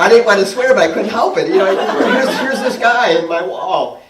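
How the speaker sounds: loud, high-pitched excited retelling; excited exclaiming. Real, not acted. Excited retelling over audience laughter.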